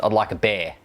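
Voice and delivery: "I'd like a bear." with beer said as bear, New Zealand style; light New Zealand accent on "I'd like a bear."; a touch of Kiwi accent on the order. Shown as a demonstration. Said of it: "Or if you're in New Zealand".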